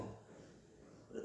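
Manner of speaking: plain reading voice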